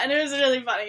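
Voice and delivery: ordinary talk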